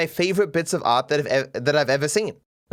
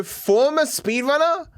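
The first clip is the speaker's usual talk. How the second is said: slow, high incredulous question; drawn-out, offended query; indignant disbelief; 9 semitones higher than usual. Real, not acted. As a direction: repeat it slowly as if affronted, rising high. Offended reading of a jab at him.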